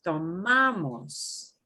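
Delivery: tomamos with MA held and punched, falling after; stress on second-to-last syllable. Shown as a demonstration. Teacher pointing out the second to last syllable, stressed MA in tomamos. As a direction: stretch the MA in tomamos. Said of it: "The second to the last syllable is".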